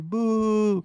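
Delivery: long, high-pitched mock boo; drawn-out jeer, pitched high; imitated booing. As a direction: boo like a crowd driving someone offstage. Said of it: "Everyone's booing the guy off the stage".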